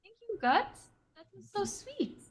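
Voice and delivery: high, soft in-character gratitude; sweet thanks. Acted. Iris to Guts after getting berries, sweetly grateful. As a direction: coo a small, high thank-you.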